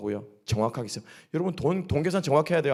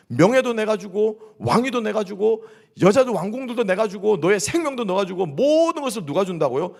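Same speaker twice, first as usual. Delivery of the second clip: higher, louder insistent list; emphatic God; 6 semitones higher, 6 dB louder than usual. Retold or imitated. Preacher voices God declaring himself giver of everything, emphatically. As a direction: drive home each item, imitating divine authority. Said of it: "분명하게 하나님께서 말씀하시는".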